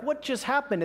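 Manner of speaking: high-pitched acted astonishment; shocked. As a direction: blurt it out in mock shock. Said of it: "everyone's shocked around this fire".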